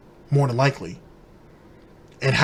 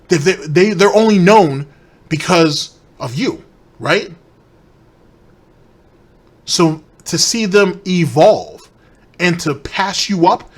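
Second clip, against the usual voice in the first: raised, heated rant at Phil, louder; ranting tantrum; 5 semitones higher, 7 dB louder, slower than usual. Real, not acted. Streamer ranting at Phil over petty bans, heated and louder. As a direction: tear into him, voice climbing. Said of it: "That was a bit of a tantrum".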